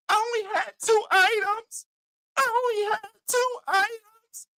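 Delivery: high mock whine of a shopper caught cutting. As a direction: mimic her pleading in a high voice.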